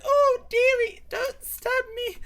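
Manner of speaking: very high, loud mock-granny squeal; pleading old lady. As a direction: beg slowly in a hammed-up old lady falsetto. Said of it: "And she was like".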